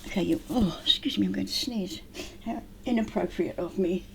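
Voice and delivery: soft ASMR whisper; breathy close-mic whisper. Acted.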